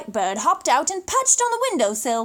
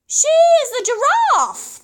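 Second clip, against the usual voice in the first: higher and louder, proudly announcing; proud pelican; 10 semitones higher, 7 dB louder than usual. Acted. Pelican proudly tells the boy about the giraffe. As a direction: present her like a showman.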